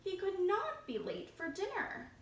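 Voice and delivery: plain reading voice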